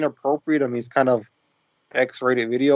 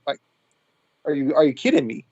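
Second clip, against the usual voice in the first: normal talking voice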